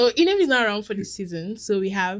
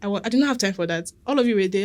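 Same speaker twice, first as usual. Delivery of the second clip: ordinary talk, nothing marked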